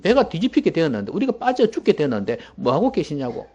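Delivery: high-pitched agitated protest; frantic disciples. Acted. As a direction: play disciples clamoring at their teacher. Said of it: "막 그렇게 야단법석이었습니다".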